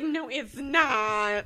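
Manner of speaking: very slow, drawn-out denial; retold firm denial. Mom denying it's from My Fair Lady, slow drawn-out denial. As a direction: stretch each word out as a disapproving mom.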